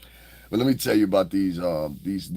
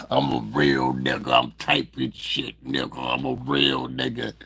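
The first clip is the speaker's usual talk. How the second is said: mock tough guy; slower than usual. Mockingly acts out the commenter.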